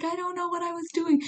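answering her mentor, high and flustered; flustered protest. Retold or imitated. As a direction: squeak it out like a caught kid. Her flustered reply to Joe.

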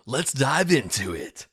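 loud, high, drawn-out hype; mock cliche podcast intro. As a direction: overblown podcast-host kickoff. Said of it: "most cliche way possible to start an episode".

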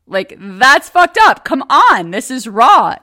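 husband mock-shouting that it's raw; mock outrage. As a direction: playfully cry foul at full volume. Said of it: "he's like".

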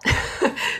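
quick unvoiced chuckle; a little laugh at her own joke; short breathy laugh. Real, not acted. Host laughing at herself for being a terrible employee, short breathy laugh.

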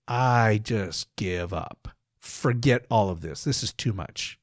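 drawn-out, low, worn-down resignation; slow sigh of surrender; weary giving up. Acted. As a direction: sag into defeat, voice dropping away. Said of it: "to the point that you say".

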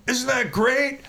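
high, loud, laughing brag about a take; boastful American. Retold or imitated. Canadian drummer mocking Americans, a loud laughing brag. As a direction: crow like a thrilled American, laughing. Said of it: "if you were an American, you'd be going".

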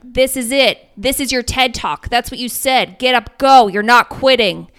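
coaching yell. Retold or imitated. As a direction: fire up the exhausted runner, no mercy. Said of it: "terrible yelling at you".

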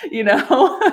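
giggling through a mock complaint; laughing at her own exasperation. Real, not acted. Laughing at the memory.

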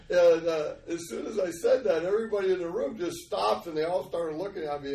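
ordinary talk, nothing marked

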